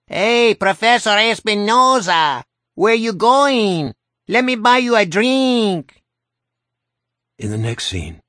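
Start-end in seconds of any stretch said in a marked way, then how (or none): 0.1-6.0 loud, high cartoonish Mexican accent; Speedy Gonzales accent. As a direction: hail him across the street in a hammy Mexican accent. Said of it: "in another hideous Speedy Gonzales accent".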